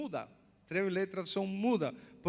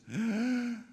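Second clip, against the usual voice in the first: long outward breath sigh; breathy exhale as the divine name; 3 semitones higher than usual. Shown as a demonstration. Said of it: "practique usted cómo se pronuncia".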